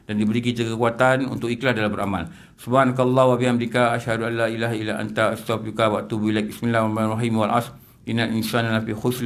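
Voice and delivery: low, even recitation of the closing prayer. Acted. Preacher closing the lesson for his listeners with a low devout prayer. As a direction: murmur the Arabic blessings devoutly, settled.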